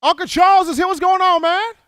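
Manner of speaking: high, loud delighted welcome; light up as a favourite regular calls in; excited greeting. Real, not acted. Host thrilled to hear Uncle Charles on the line, high and loud.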